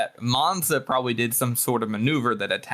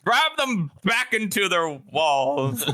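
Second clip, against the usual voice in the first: higher, slow hammed-up order; mock general's command; 8 semitones higher, 3 dB louder, slower than usual. Friend joins the joke about the general, slow mock command. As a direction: drag out the pompous command.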